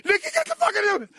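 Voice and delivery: put-on high furious yell at the kid; screaming at kid. Father screaming at his nagging son, loud and high. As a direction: scream it at the little boy. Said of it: "screaming at him".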